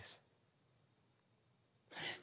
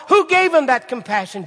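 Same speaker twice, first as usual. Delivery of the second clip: loud, high preaching cry; 10 semitones higher, 12 dB louder than usual. Acted. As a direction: preach it out with full force. Preaching at full force.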